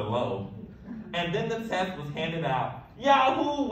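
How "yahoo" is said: high, loud whoop of joy; joyful yell. A narrator's reading. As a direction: shout it like you just won. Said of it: "we yelled".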